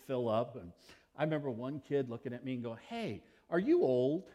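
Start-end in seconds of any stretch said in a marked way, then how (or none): 2.9-4.3 put-on curious kid. Kid asking at lunch table.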